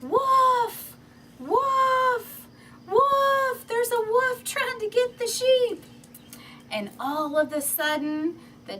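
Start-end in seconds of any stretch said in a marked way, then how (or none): 0.0-5.8 put-on high, loud alarm cry of a boy; shout. Shepherd boy on the hill calling the town, loud fake alarm. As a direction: yell it from the hilltop, much higher and louder. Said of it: "he yelled".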